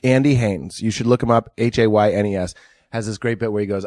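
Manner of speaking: ordinary talk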